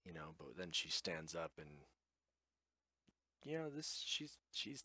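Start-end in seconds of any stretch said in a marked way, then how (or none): none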